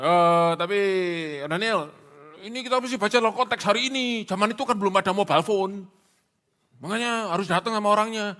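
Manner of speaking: high, loud mock objection; mock protesting listener. Congregant objecting to Daniel about modern mobile phones, mock protest. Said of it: "saudara mungkin bilang".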